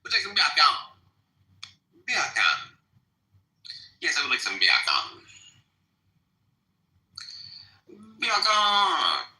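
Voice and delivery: "bacon" as "biakan" in a light Jamaican lilt; mock Jamaican. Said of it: "Jamaican accent".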